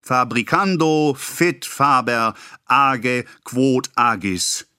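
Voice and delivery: slow, pompous Latin recitation; Latin maxim. A narrator's reading. Pharmacist quoting Latin at the boy, slow and pompous. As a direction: declaim the Latin. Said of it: "dass er Lateinisch sprach".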